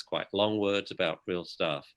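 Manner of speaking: usual speaking voice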